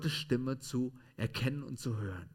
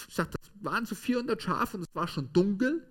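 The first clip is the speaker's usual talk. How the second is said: ordinary talk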